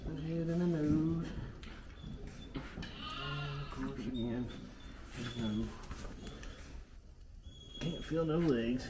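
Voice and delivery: soft, high, gentle murmur; talking to animals. Real, not acted. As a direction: murmur gently to the ewe while working. Talking softly to a ewe.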